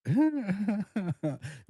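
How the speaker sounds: big high-pitched laugh; real laugh, caught off guard. Real, not acted. Laughs at the joke.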